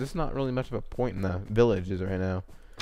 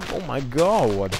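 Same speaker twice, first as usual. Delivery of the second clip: excited; 2 semitones higher, much slower than usual. Real, not acted. Gasps at making golem.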